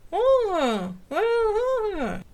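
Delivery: high, loud, crying ahs through a stuck-open mouth; straining to speak. A narrator's reading. Scully, mouth held open by a tooth, strains to form words. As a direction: cry out, unable to close the mouth. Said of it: "struggling to form words".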